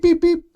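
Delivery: high electronic beep, beep imitated; pager beeps. Recalling the pager going off, high mock beeps. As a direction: chirp it like an old pager.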